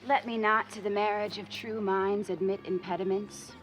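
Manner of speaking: low, measured recital of a sonnet; poem recitation. Acted. Rival recites a sonnet at her former friend, measured poem recitation. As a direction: recite the verse low, letting the last words fade.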